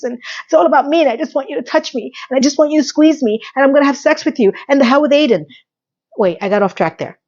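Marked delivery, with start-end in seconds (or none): 0.0-5.4 fast, pitched up, breathless; racing mock thoughts. Vlogger mocking Carrie's inner fantasy, fast and high. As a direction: rattle off the fantasy faster and faster.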